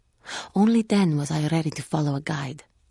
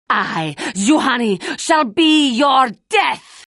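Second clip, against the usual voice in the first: slow, drawn-out roar, much higher and louder; battle cry; 9 semitones higher, 8 dB louder, slower than usual. Acted. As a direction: bellow the death threat to start the fight. Villain's battle challenge.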